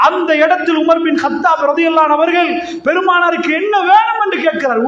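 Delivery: normal talking voice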